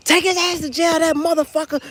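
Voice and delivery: high, loud furious demand; yelling woman. Retold or imitated. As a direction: scream it at the cop. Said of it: "She's like".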